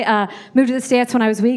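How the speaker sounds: ordinary talk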